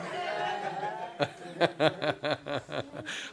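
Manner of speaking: a full, high laugh; long hearty laugh. Real, not acted. Preacher to congregation, laughing at the umbrella story.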